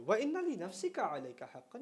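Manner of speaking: recited Arabic. Shown as a demonstration. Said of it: "recites hadith".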